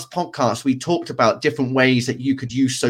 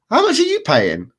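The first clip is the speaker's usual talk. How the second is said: surprised question; 12 semitones higher than usual. Real, not acted. Asks the price of the booked hotel.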